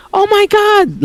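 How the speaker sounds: very high mimicked shriek, volume held back; mock a mom's panicked scream. Bullies making fun of his mom, mocking scream. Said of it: "making fun of the fact that my mom screamed".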